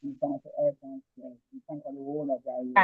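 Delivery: faint, far from the mic; speaking away from the phone; phone held away. Real, not acted. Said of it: "Can hardly hear you".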